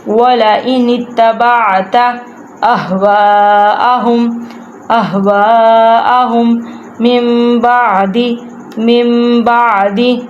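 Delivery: slow, measured tajweed recitation with repeats; Quran recitation. Shown as a demonstration. Teacher recites verse for students to follow, measured chant. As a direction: chant each phrase, then repeat it.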